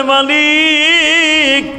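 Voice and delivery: high, long-held call to the Master; imitated weeping, sung on one stretched word; wailed cry. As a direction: cry it out as a drawn-out chant. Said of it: "কান্দে আর বলে".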